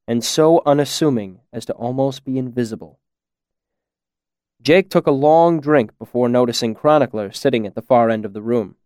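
ordinary delivery

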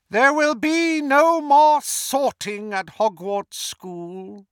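high, loud, drawn-out villain decree; Voldemort. A narrator's reading. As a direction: proclaim it to the crowd, then drop away. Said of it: "said Voldemort".

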